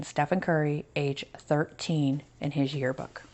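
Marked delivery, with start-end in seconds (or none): none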